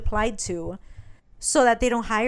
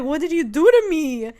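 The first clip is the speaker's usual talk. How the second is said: raised, higher accusing question; acted alarm; 4 semitones higher, 7 dB louder than usual. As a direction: imitate a panicked captive, louder. Acting the drugged girl confronting him.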